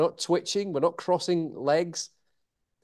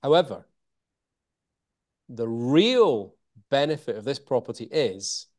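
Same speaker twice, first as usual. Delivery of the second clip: sales pitch, hold a beat after "However", stretch "real"; pause around HOWEVER; 2 semitones lower than usual. Shown as a demonstration. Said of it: "We have a pause and then go".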